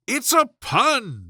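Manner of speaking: high, slow, wounded pride; the king sulks over his joke; offended king. A narrator's reading. King offended that nobody laughed, slow and high. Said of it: "in an offended tone".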